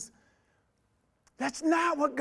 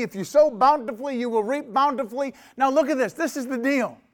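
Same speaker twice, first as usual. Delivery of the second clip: normal talking voice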